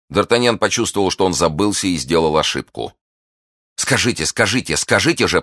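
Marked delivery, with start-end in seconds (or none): 3.8-5.4 insistent, higher-pitched repeated demand; urgent pleading. A narrator's reading. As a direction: press harder with each repetition. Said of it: "повторила миледи". (настойчиво, требовательно)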